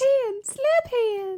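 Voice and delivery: high goofy Bobby Boucher squeal; Waterboy voice. Retold or imitated. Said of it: "like, the Waterboy voice".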